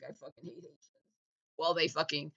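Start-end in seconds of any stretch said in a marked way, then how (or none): 0.0-0.9 mumbled mock quote. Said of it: "sitting there on the beach like".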